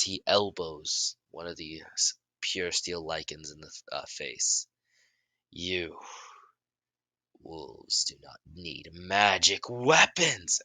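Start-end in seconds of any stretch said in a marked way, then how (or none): 5.5-10.6 building yell. A narrator's reading. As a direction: start contained, swell into a drawn-out shout. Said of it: "as he yells out".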